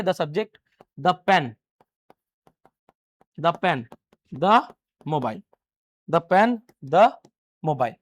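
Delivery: "the pen" and "the mobile" with "the" said द; short "the" before each consonant noun; the as thuh. Shown as a demonstration. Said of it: "किससे शुरू हो रहे हैं". ("the" को द बोलिए)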